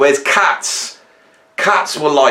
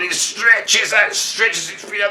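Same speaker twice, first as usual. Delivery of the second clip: slow stretch; 9 semitones higher, 3 dB quieter than usual. Acted. Acting the cat's slow stretch.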